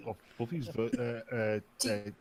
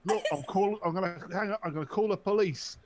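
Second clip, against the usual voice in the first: high mock yell, settling into huffy threat; hammed-up outraged Englishman; mock shouting threat; 6 semitones higher, 5 dB louder than usual. Acted phone quarrel.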